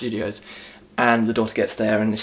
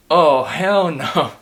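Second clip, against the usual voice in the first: mock driver's dread; 4 semitones higher, 6 dB louder, much slower than usual. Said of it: "the guy goes".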